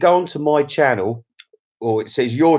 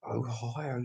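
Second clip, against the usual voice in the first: "Ohio" said again much softer, almost to himself; very soft repeat; 2 semitones lower, 17 dB quieter than usual. Shown as a demonstration. Vlogger softly echoing a viewer's home state.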